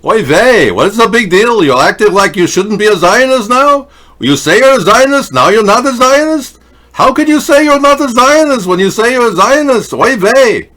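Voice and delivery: put-on high, loud Yiddish-accented kvetching; exasperated Yiddish accent, oy vey.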